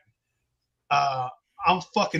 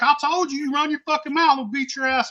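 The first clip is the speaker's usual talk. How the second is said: angry threat; 8 semitones higher than usual. Retold or imitated. Threatening while held back.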